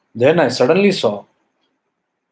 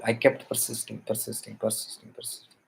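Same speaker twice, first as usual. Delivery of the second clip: each persisting softer until it dies away; fading repetition; 2 semitones lower, 12 dB quieter than usual. Retold or imitated. Telling listeners how he kept pushing, fading repetition. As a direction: let the word fade out to nothing. Repeated word dying away.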